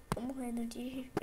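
breathless whisper of laughter. Real, not acted. Repeats the phrase in new ways.

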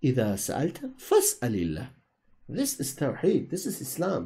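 normal talking voice

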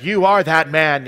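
loud, slow proclamation; acted accusation. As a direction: thunder it like a prophet's charge. Quoting prophet's accusation flashing in head.

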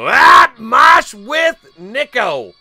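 very high, loud, slow holler; big drawn-out high shout; shouted winner announcement. Real, not acted. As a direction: bellow out the drawn name, stretched out. Announces the newly drawn name.

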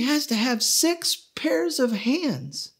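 God whispering the impossible last requirement; whispered. Acted. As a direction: breathe it out slowly like a secret. God adds the hardest requirement.

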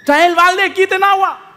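high, loud frantic orders; frantic shouting. Acted. Disciple yelling at crewmates to bail water, frantic shout. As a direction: yell over the storm to bail water.

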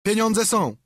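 high loud shout in another voice; shouting back. A narrator's reading. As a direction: call it down from the window. Pirate answers from window. (okrzyk pirata z okna)

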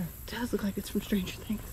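soft unvoiced whisper. Real, not acted. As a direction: whisper it under your breath. Quiet aside while viewing spider.

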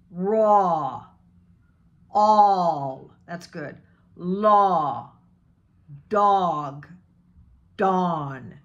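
lips rounded for "Raw", "all", "Law"; rounded aw vowel. Shown as a demonstration. Coach models rounded aw for the class. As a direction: draw out a rounded "dawn". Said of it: "Round your lips".

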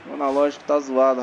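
ordinary delivery

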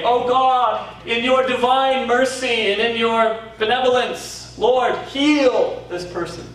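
high, slow, hammed-up churchy prayer; mock pompous prayer. Preacher mocking long-winded prayers to the congregation in a hammed-up voice. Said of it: "He never prayed".

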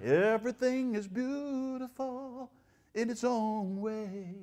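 high, slow, sugary singsong; mocking the flowery preachers with a sweet lilt; mock flowery sweetness. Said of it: "there are guys who are flowery".

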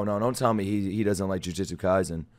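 ordinary talk

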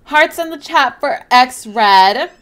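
slow, loud hype for X Red; hyped callout. Real, not acted. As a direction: call it out to the whole chat. Repeats call to chat, hyping.

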